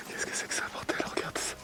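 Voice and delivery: hushed, breathless whisper. Real, not acted. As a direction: whisper so nothing hears you. Exploring in the dark, frightened.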